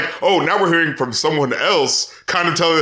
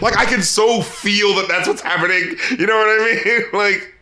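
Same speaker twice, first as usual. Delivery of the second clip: ordinary talk, nothing marked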